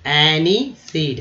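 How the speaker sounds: एनीसीड drawn out, "a-nee-seed"; slow demo. Shown as a demonstration. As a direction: build एनीसीड slowly. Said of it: "ऐसे बनाएंगे". (एनीसीड धीरे बोलें)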